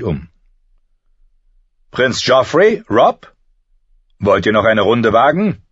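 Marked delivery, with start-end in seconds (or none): 1.9-5.6 loud, lifted summons; calling out. A narrator's reading. Master-at-arms summoning prince and Robb, calling out. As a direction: call the next pair forward, higher and louder.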